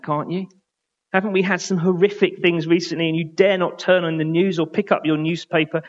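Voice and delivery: ordinary talk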